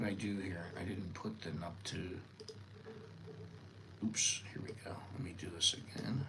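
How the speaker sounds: whispered aside to himself; real whispered muttering while fixing a mistake. Real, not acted. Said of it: "fixing slide mid-lesson".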